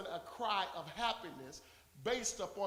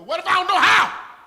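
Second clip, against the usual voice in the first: loud, higher plea; desperate cry; 10 semitones higher, 19 dB louder than usual. Pretend. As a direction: cry out that you don't know how. Pleading cry answering the scold.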